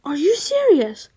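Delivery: loud, stunned question; shocked disbelief. Acted. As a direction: blurt it out in disbelief. Reading shocked character line.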